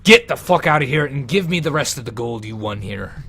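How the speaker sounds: loud, forceful threat; angry order. Acted. Attacker orders player out.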